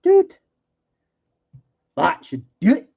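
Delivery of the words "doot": high, loud little doot; playful doot. Pretend. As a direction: squeak it like a toy horn. Playful sound while gluing.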